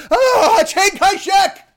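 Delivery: very high, loud mock shriek; screaming out. Old patient's bad memory suddenly surfacing, screaming out. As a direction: burst into a slow, stretched scream. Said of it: "they just scream out".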